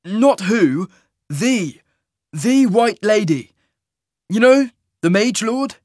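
"the" set apart and stressed, high and loud; emphatic correction. Acted. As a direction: correct her, leaning hard on "the". Hero corrects the baker's misunderstanding.

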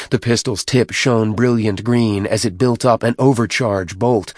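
plain reading voice

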